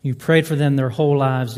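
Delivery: ordinary talk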